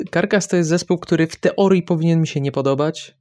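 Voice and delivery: ordinary talk, nothing marked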